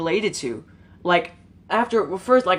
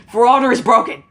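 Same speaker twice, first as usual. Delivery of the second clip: exasperated outburst; 6 semitones higher, 8 dB louder, faster than usual. Real, not acted. Venting about a game.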